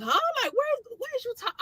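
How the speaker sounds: very high, baffled squeak; retold confusion. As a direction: squeal it in mock bewilderment. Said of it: "I'm genuinely confused".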